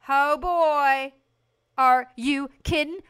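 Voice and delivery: gleeful disbelief, belted out; amazed delight. Real, not acted. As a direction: marvel at it at full volume. Amazed at the painted wall.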